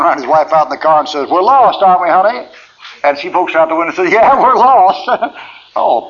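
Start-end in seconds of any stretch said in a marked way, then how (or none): 1.3-2.5 lost traveller husband. Retold or imitated. Imitating lost husband at door. 4.1-5.4 high and loud, laughing through it; wife laughing along. Retold or imitated. Imitating wife from car window.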